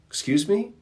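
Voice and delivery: high, incredulous "Excuse me?"; indignant surprise. Retold or imitated. Michelangelo to the Pope, high, incredulous surprise. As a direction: Michelangelo can't believe he's offered just the ceiling. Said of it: "And he goes".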